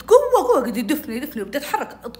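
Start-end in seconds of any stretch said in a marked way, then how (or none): 0.0-0.6 high, loud urging; excited cheering. Retold or imitated. Happy girl on the swing to friend, excited shouting. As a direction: cry out for a bigger push. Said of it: "أنا هنا انبسطت".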